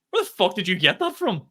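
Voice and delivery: high, loud astonishment; astonished. Retold or imitated. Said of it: "And, like".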